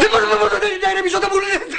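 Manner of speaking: high, frantic and fast refusal; hysterical outburst. Acted. Husband told by wife to calm down, hysterical. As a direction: race through it, pitched high, beside yourself.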